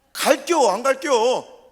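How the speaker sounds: loud, slow 갈겨, 안 갈겨 in broad Chungcheong dialect; Chungcheong drawl. Retold or imitated. Preacher imitating a Chungcheong local dodging a yes or no. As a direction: stretch the question lazily like a rural elder. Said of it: "충청도는".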